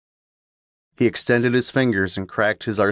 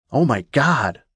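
shocked cry; 3 semitones higher than usual. Acted. Guard finds corpse.

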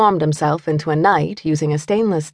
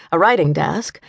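usual speaking voice

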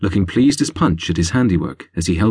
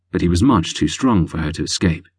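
plain reading voice

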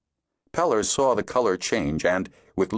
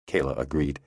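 ordinary delivery

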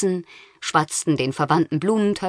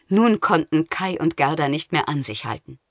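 ordinary delivery, nothing marked

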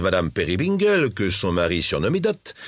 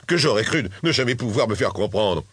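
plain reading voice

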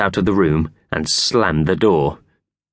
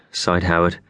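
ordinary delivery, nothing marked

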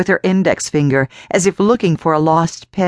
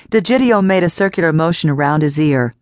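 plain reading voice

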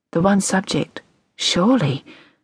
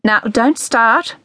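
slow, firm warning, hand raised; 6 semitones higher, slower than usual. A narrator's reading. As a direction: halt her like a traffic warden. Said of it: "raising a hand like a traffic warden".

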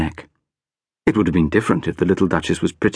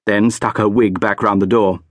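plain reading voice

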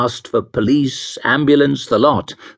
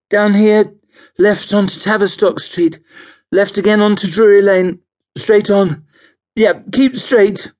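high, raised, insistent voice; calling out directions; 10 semitones higher, 4 dB louder than usual. Acted. Drunk passenger directs the cabbie, calling out. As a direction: call the route out high and strained.

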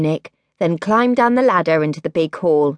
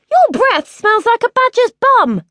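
high, louder rude shout; cheeky yell; 5 dB louder than usual. A narrator's reading. Princess shouting insult at her dog. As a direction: fling the insult at him.

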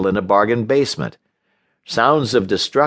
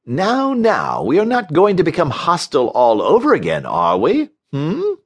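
high, singsong placating; condescending lilt with a high questioning hum; patronizing soothing; 6 semitones higher than usual. A narrator's reading. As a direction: placate as if scolding a small child. Titus placates Barry like a child.

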